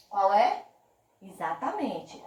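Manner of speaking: ordinary talk, nothing marked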